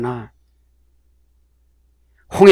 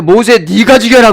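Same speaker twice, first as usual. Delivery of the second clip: loud, higher divine order; commanding voice; 7 semitones higher, 7 dB louder than usual. Acted. God commands Moses to kill, loud and high. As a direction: give the order like God himself.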